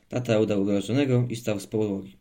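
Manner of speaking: ordinary delivery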